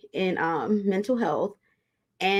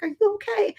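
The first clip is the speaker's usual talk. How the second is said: much higher, anxious question; worried client. Retold or imitated. Client hearing her therapist's wrecked voice, high and anxious. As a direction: pitch it way up like a scared client.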